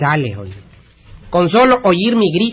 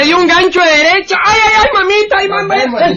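crying in pain; 12 semitones higher than usual.

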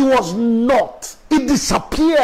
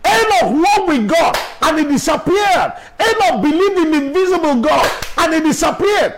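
full-force preaching; 7 semitones higher than usual. Acted. Pastor urging the church to believe, preaching at full force. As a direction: preach it out to the whole congregation.

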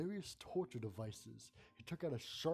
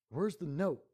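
louder, sharp demand; 4 semitones higher, 7 dB louder than usual. Acted. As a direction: demand it angrily. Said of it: "I demanded".

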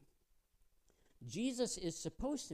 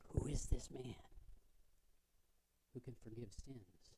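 whispered, unvoiced question; murmuring guests; 5 semitones lower, 6 dB quieter than usual. Party guests whispering about Jesus, hushed. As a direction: hiss it to the guest beside you.

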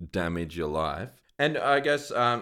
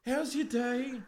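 bright, high customer-service greeting; cheerful shop worker. Retold or imitated. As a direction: chirp it like an upbeat cashier. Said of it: "they're like".

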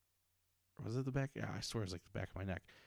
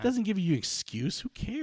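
high, loud exasperated rant; fed up and scoffing; indignant outburst; 11 semitones higher, 6 dB louder than usual. Real, not acted. Venting to a friend about the teacher, high indignant rant.

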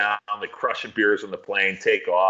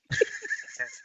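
high laugh; 8 dB quieter than usual. Real, not acted. Reacting to the headbutt.

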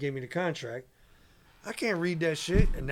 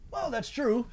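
higher-pitched, imitated grudging agreement; awkward concession; 8 semitones higher, slower than usual. Mogul answers the rapper's bold pitch, awkwardly conceding. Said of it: "He got all awkwardly silent".

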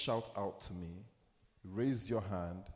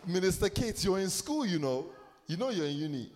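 excited teasing; 8 semitones higher, 6 dB louder than usual. Real, not acted. Teasing call-out to congregant.

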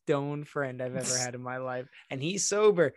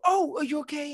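high-pitched alarmed check; mock panic, pitched way up; alarmed scream; 10 semitones higher than usual. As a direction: overreact to a kid's tumble. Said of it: "if you scream".

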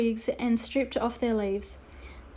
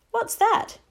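put-on puzzled boy; 11 semitones higher than usual.

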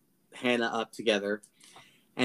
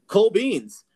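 mock breezy townsfolk; 8 semitones higher, 8 dB louder than usual. Townsfolk mockingly accepting confession.

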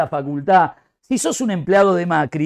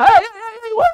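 mock dog yelp; 14 semitones higher, 4 dB louder than usual. Perro huye asustado.

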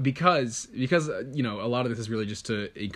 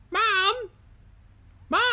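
very high-pitched English cub calling for Mom; English baby polar bear. Acted. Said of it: "I don't know why he's English".

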